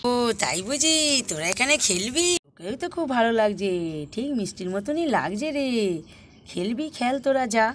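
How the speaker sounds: normal talking voice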